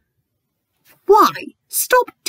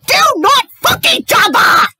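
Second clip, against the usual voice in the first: shouting; 4 semitones higher, 4 dB louder than usual. Real, not acted. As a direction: shout it in disgust. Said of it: "she just screams".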